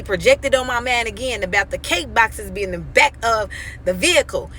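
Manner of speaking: ordinary talk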